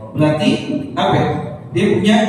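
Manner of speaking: ordinary talk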